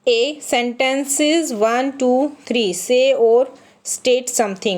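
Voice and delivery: very slow textbook reading; teacher reading from the book, slow; slow read-aloud. Shown as a demonstration. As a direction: read each word out slowly. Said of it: "reading textbook slowly".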